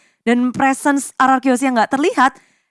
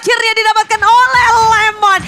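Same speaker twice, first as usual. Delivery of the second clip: fast, high, hyped play-by-play; fighting game announcer; 8 semitones higher, 3 dB louder, faster than usual. Real, not acted. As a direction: scream the big plays out fast. Climactic teamfight play-by-play.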